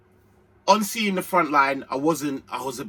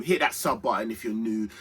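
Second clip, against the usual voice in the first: ordinary delivery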